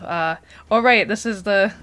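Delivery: ordinary talk, nothing marked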